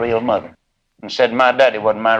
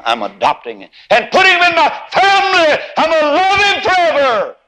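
sing-song sermon peak, soaring high; whooping preacher; 11 semitones higher than usual. Real, not acted.

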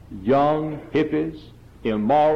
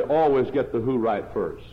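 ordinary delivery

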